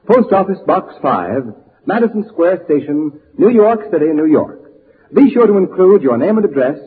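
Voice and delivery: normal talking voice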